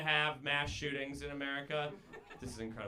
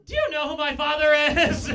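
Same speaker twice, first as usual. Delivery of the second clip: much higher, shouted spoiled brat; entitled rich-kid Jesus; 13 semitones higher, 15 dB louder than usual. Acted. Jesus as rich Harvard kid shouting at the crowd, higher and loud. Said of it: "This is if Jesus went to Harvard".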